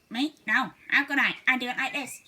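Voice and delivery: a quick hare denying it. Acted. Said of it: "answered the hare".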